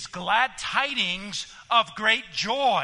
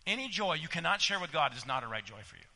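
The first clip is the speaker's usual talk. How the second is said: brisk, softer run-through of "Any joy you cannot share"; fast quiet restatement; 3 semitones lower, 4 dB quieter, faster than usual. Acted. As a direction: rattle it off again, low and quick. Repeating the Spurgeon quote.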